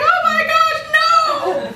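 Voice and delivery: horrified but cracking up; laughing shock. Retold or imitated. Retelling her shocked reaction.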